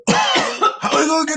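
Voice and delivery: high, lightly voiced scheming stoner; comic smuggler impression; mock smuggler voice. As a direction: wonder aloud in a high mock voice. Voicing the smugglers planning.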